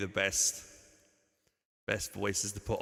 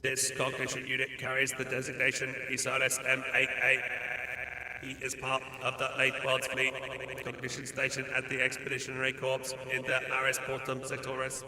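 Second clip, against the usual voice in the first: megaphone voice. Shown as a demonstration. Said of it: "This one is megaphone".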